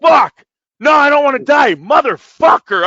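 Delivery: loud panicked yell, cursing; retold kid yelling. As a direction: shout it like a kid scared out of his wits. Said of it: "He's like".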